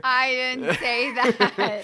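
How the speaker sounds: slow, loud laughing denial. Real, not acted. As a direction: deny it while laughing.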